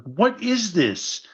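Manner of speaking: high, drawn-out incredulous question; slow, high-pitched retold bafflement; mock disbelief. Retelling his disbelief at the paintings.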